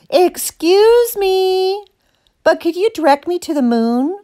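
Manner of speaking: high, sing-out polite call; high-voiced cow hailing passers-by. A narrator's reading. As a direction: stretch EXCUSE ME high to flag someone down. Cow calls out to passing skaters.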